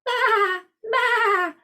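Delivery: high, quavering bleat; sheep's bleat. A narrator's reading. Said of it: "bleated the sheep".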